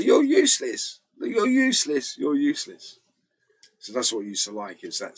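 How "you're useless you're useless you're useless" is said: mocking high put-down, repeated; mock taunt. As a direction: jeer it in a higher voice, fading on the last. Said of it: "You go".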